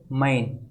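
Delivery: मैन with an open AI sound; big AI vowel. Shown as a demonstration. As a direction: open मैन out towards 'main'. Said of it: "बड़े ए का sound आता है".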